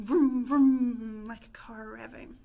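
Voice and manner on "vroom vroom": loud lip-buzzing vroom; silly clown. Shown as a demonstration. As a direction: buzz your lips like a revving car. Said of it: "lips vibrate and go vroom".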